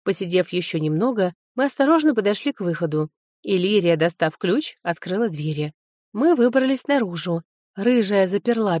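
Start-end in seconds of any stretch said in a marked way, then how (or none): none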